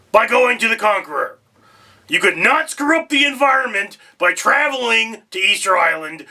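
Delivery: loud, high-pitched alien host. Acted. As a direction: boom it out like a pushy alien. Alien host closes the video.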